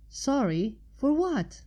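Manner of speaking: confused and worried; alarmed question. A narrator's reading. Twilight alarmed.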